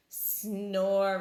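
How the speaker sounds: low, loud snoring; snore. A narrator's reading. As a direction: snore heavily. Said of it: "replied Bear".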